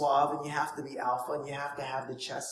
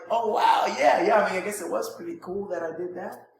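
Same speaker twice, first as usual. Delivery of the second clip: loud, higher, flattered gush with a laugh; excited prospect; 5 semitones higher, 7 dB louder than usual. Retold or imitated. Prospect flattered by the compliment, gushing loudly with a laugh. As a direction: gush like someone thrilled to be asked. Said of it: "Now they're excited".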